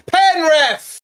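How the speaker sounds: high, loud shout at the ref; penalty appeal shout. Real, not acted. Fan screaming at the referee for a penalty, urgent shout. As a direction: demand the penalty.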